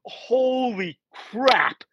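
high, very slow holy crap; slow dawning realization. Retold or imitated. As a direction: let it dawn on him slowly. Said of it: "he's like".

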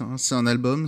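ordinary delivery

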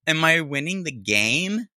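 mimicking the woman. Host mocking a mom of three boys, drawn-out mimicry. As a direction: draw the question out in mockery.

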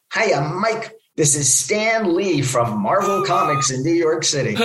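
exuberant caller impression; Stan Lee. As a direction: introduce yourself with hammed-up enthusiasm, starting high. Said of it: "it's this exuberant male voice".

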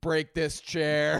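slow, loud, high bellow; drunk dad yell. Pretend.

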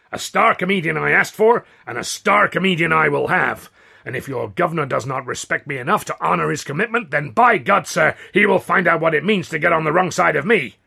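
loud, high, indignant threats; pompous angry ultimatum; blustering demand. A narrator's reading. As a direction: lay down the law in outrage. Considine threatens, demanding a star.

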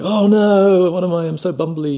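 high-pitched, hammed-up bumbler; mock dismay. Waiter faking clumsiness after dropping plate, mock dismay. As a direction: fret in an exaggerated high voice.

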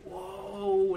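high startled exclamation; surprised. Retold or imitated. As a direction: gasp it out in shock. Crowd reacting to heel turn.

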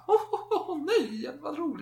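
very high, airy, giggly gush; mock frivolous queen. Earlier carefree queen gushing over everything, mocking high voice. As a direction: play the queen as a careless airhead.

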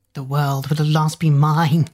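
menacing witch queen voice; villainous queen. A narrator's reading. Witch Queen declaring her coming triumph, villainous gloating. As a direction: gloat like a wicked queen claiming power.

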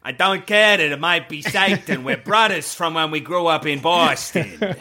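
mock a film priest's terrible Boston accent; bad Boston accent. Mocking the main priest's lines to co-hosts, bad Boston accent. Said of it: "the worst fucking accent that's ever appeared".

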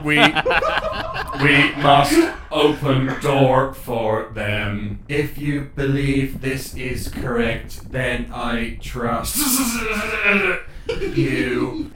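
slow, glitching machine voice; robot character. Acted. Warforged fighting their programming to help friends, slow halting robot voice. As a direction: struggle through it like a short-circuiting robot. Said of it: "Shiny then goes".

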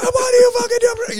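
high furious yelled question; mock road-rage yell. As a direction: bellow at another car.

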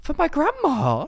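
imitating a baffled partner, giggling; confused boyfriend. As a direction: ask in laughing disbelief. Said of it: "Andrew goes".

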